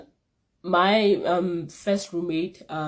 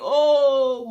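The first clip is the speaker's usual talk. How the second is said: dramatic oh; 8 semitones higher, 8 dB louder than usual. Real, not acted. Dramatic exclamation.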